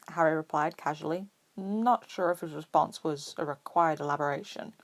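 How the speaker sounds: ordinary delivery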